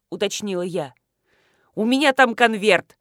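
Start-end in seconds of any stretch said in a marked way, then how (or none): 1.8-3.0 loud, irritated bark. A narrator's reading. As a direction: bark it out impatiently. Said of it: "рявкнул Александр Михайлович". (громко рявкнуть)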